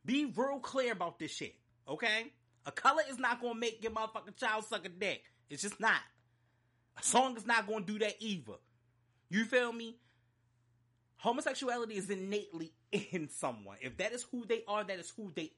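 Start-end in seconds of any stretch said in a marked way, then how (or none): 0.0-10.0 higher, forceful, emphatic telling-off; mad, real plain. Real, not acted. Laying it down for the people being scolded, plain and mad. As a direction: spell it out angrily, point by point. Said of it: "I'm saying it real plain, real simple, real clear".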